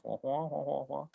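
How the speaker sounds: soft muted trombone-like wah wah; muffled wah wah. Retold or imitated. How a lecture sounds to the team, soft droning wah wah. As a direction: drone meaningless wahs softly. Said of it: "what it sounds like to everybody else".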